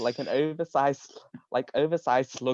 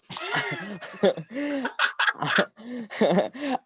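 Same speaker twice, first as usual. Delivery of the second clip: rising laugh; 10 semitones higher than usual. Real, not acted. Reader laughs before next line.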